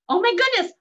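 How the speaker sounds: high, loud retold shock; astonished reaction. Woman reacting to stranger bursting into tears, astonished. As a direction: exclaim it, taken aback.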